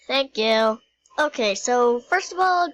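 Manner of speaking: ordinary talk, nothing marked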